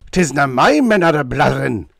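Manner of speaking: loud Scottish burr in high dudgeon; indignant Scottish burr, loud. A narrator's reading. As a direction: bristle with Scottish offence. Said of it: "declared the Chief in high dudgeon".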